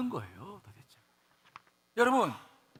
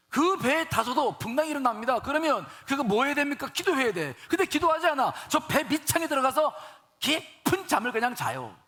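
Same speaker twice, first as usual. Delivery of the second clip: raised, high-pitched sermon delivery; forceful preaching; 5 semitones higher, 5 dB louder than usual. Real, not acted. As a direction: drive the story home to the congregation. (높은 목소리로 힘주어 설교하듯)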